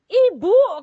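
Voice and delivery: loud, high call to the patient; excited calling out. Retold or imitated. Nurse to patient with good news, imitated excited call.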